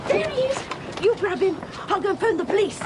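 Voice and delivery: high urgent alarm, pointing him out; sudden panicked urgency; excited alarm. Acted. Spotting the burglar.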